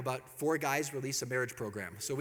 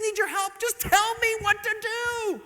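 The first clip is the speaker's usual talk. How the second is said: high, slow whiny plea; helpless husband; 8 dB louder than usual. Pretend. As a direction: play the clueless husband begging.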